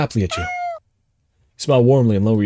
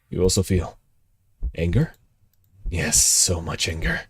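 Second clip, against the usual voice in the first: slow, low, seething read; low simmering menace; brooding; 4 semitones lower, 5 dB quieter, slower than usual. Acted. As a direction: savour the anger slowly. Reading game text dramatically.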